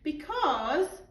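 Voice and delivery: group shout. A narrator's reading. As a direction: call it out together. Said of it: "shouted Lindy".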